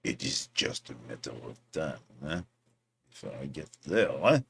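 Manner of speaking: put-on low, quiet French accent. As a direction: murmur it with a mock French accent. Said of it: "How's my French accent?".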